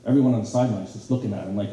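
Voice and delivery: ordinary talk, nothing marked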